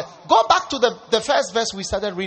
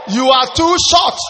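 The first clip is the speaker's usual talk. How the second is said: higher, louder mocking jab at his height; scornful shout; 6 semitones higher, 7 dB louder than usual. Woman sizing up a short suitor, shouting her scorn. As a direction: fling the insult out loud.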